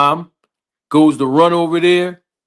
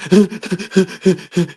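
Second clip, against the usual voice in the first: a child's sobbing imitated; imitated sob; 3 semitones higher than usual. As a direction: sob like the crying son. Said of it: "still crying".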